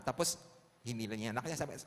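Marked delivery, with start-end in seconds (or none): none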